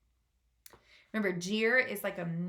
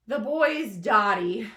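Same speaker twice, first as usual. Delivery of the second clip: loud, slow mocking jeer; mocking centipede; 3 semitones higher, 11 dB louder, slower than usual. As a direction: laugh him off. Centipede mocks James.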